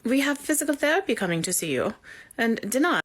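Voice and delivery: German nurse's accent, lightly voiced. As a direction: play the firm German nurse, a hint of accent. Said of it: "She hit in an accent".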